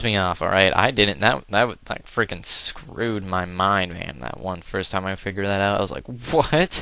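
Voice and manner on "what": mock surprise. Recalls being confused in battle.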